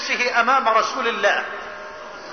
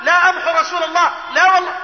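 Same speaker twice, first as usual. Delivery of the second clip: high, emphatic retold outcry; outraged refusal; 6 semitones higher, faster than usual. Companions who cannot bear the terms, outraged refusal. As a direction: refuse forcefully, quick and raised in pitch. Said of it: "مش، مش قادرين يتحملوا".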